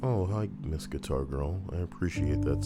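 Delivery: normal talking voice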